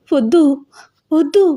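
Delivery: high-pitched refusal, rising at the end; mildly acted fussy objection; fussing protest. A narrator's reading. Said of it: "అని గోల చేస్తే".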